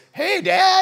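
high, loud eager son calling in; Jacob's cheery greeting. Acted. Jacob greets his blind father in a high, loud eager voice. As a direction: son sneaking in with a bright call.

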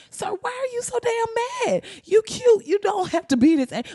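high, imploring voice to the angry man; pleading woman. Retold or imitated. Woman pleading with the angry brother, high and imploring. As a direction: plead with him, almost swooning.

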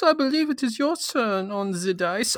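high, put-on game master voice; a lofty host persona.

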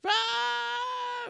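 sudden very high shout; a hammed-up CSI Miami theme yell; mock yelled music sting. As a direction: belt it like a TV theme scream.

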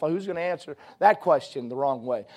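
ordinary talk

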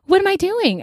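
high, mock-clueless squeak; hammed-up airhead; ditzy damsel squeak. Mimicking the clueless blonde woman.

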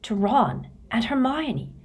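plain reading voice

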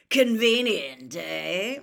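loud, slow growled jibe; drawn-out, knowing growl. A narrator's reading. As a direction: growl it with a pointed questioning end. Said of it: "growled a voice from near the door".

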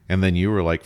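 ordinary delivery

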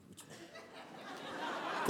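breathless whispered echo; whispered astonished repeat; stunned whisper. Retold or imitated. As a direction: whisper it back in disbelief. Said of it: "I'm like".

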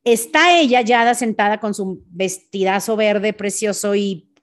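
ordinary talk, nothing marked

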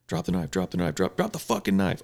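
low, soft repeated drop the knife; droning the same order over and over; imitating stuck cops. Mocking fellow cops who just keep repeating drop the knife.

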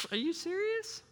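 very high, squeaky mock denial; fake innocence. Son lying to his dad about hitting golf balls, high squeaky innocence. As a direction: play dumb with a cracking high voice. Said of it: "I'm like".

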